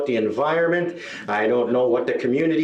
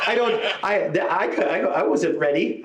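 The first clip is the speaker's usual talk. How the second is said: choked, higher stammer, as if crying; mock crying; 4 semitones higher than usual. Comedian recalls crying in the back corner, mock tearful. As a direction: stumble tearfully over the words. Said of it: "I was in the back corner crying".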